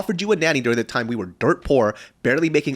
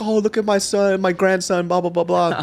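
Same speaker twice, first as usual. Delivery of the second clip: high-pitched mock gushing over a grandson; doting grandpa; 8 semitones higher than usual. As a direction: gush like a proud grandpa, higher. Dad doting on grandson, imitated.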